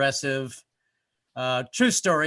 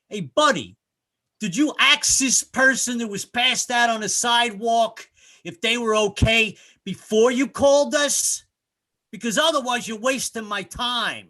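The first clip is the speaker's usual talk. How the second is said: louder, higher, slow scolding; berating EMT; 7 semitones higher, 6 dB louder than usual. Retold or imitated. EMT guy berating the caller, loud slow scold. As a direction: scold him like an irritated paramedic. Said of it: "He goes".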